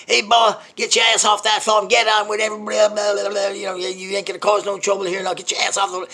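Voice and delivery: high, full-force drill sergeant yell; screaming sergeant. Retold or imitated. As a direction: bellow orders at a recruit. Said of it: "He started to scream at me".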